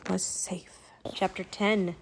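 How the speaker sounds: soft hushed whisper. A narrator's reading. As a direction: breathe the words, no voice. Whispered chapter ending.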